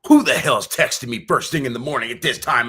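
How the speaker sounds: re-enacted morning outrage; all fired up. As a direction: demand it, indignant at an early text. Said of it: "I was all fired up".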